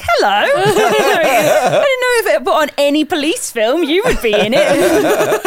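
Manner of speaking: high laughing hello. Real, not acted. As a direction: laugh through the words.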